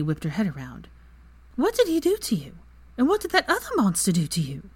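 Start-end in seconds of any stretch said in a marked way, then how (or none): 1.6-4.7 high, sharp, alarmed question; shocked demand. Acted. Hermione rounding on Draco, shocked and alarmed. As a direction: she can't believe what she's hearing.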